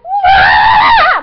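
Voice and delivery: high, loud, long "waah" scream; piercing fan scream. Retold or imitated. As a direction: scream with overjoyed excitement. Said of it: "start screaming".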